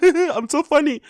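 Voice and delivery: high, pleased with himself; mock self-satisfied chuckle voice; smug self-amused. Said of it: "does that thing that Brendan does where he kinda laughs at himself".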